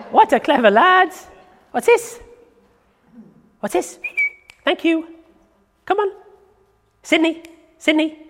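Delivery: high, eager coaxing with a laugh; excited dog talk. Real, not acted. Trainer calling her dog to bring the string, high excited voice.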